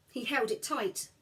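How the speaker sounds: ordinary delivery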